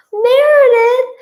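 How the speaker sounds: high, loud, drawn-out scream. A narrator's reading. Narrator catches cat breaking a vase, screaming. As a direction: scream the cat's name. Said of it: "I scream".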